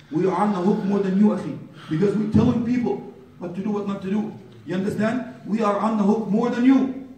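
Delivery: high, insistent warning; earnest preacher pressing the danger home; urgent preaching. Real, not acted.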